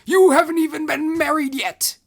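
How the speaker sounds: very high, loud scolding screech; exasperated shriek. Acted. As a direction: shriek it in disbelief. The Hierophant shrieks.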